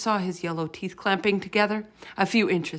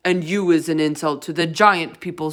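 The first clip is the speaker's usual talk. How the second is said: shouted; 9 dB louder than usual. A narrator's reading. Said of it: "shouted the blood bottler".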